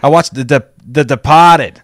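badly faked Boston accent; hammed-up amateur Boston accent; terrible Boston accent. Shown as a demonstration. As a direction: attempt Boston, dropping the Rs. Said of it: "No, it's, it's really terrible".

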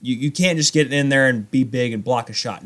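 ordinary talk, nothing marked

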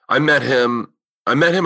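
ordinary talk

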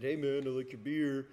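lightly voiced imitation of a coworker; slight put-on voice for a friendly invite; Leg impression. Brewer quotes coworker recruiting him at festival, lightly imitated. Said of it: "That's what I sound like".

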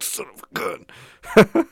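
mock straining exclamation; straining grunt. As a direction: squeeze the words out mid-effort. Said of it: "you're like".

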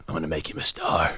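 put-on low, soft gravelly old coach; a hint of a gruff old coach. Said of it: "a gravel voice just going".

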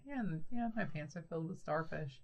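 quiet, matter-of-fact joke delivery; imitating a casual, understated punchline; straightforward deadpan. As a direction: toss it off softly like a common remark. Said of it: "it's a common thing to say".

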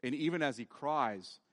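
ordinary delivery

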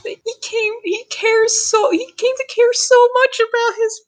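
high, tearful gush; choked-up gushing. Real, not acted. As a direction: overcome with feeling, voice way up high. Gushing tearfully about the father.

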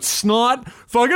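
ordinary talk, nothing marked

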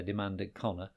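usual speaking voice

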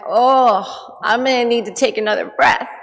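loud exasperated sigh of words; mock strained calm. Wife at slammed door, loud exasperated. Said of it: "And I went".

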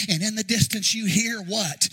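ordinary delivery

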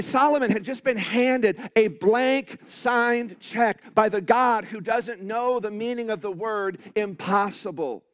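usual speaking voice

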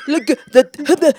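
imitated bouncer tripping over words; slow, halting mock stutter. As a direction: stammer and stick on the word. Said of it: "you're all stuttering".

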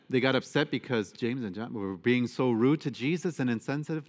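usual speaking voice

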